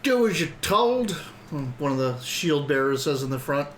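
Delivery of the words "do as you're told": barking guard. Acted. Guard orders the party.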